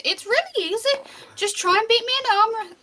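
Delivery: high, squeaky dwarf voice; squeaky little challenge; high-pitched voice. Acted. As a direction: pipe it up high, encouraging. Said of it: "trying to keep that voice up higher".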